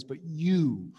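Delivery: "you" drawn out and stressed; emphatic YOU. Acted. Jesus asking his disciples point blank, emphatic YOU. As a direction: lean long and firmly on "you". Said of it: "the you is really emphatic here".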